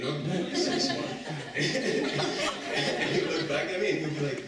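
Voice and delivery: loud, high words broken by laughter; real laughing fit while speaking; cracking up. Real, not acted. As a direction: try to tell it while helpless with laughter. Cracking up telling his joke.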